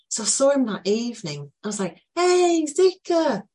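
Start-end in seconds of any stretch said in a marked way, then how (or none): 2.2-3.4 high, loud playful call; excited greeting. Retold or imitated. Teller greeting the boy, high and bright call.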